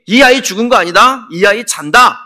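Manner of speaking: acted shout, high and forceful; loud proclamation. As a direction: proclaim it loudly over a crowd of mourners. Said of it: "큰 소리로 외칩니다".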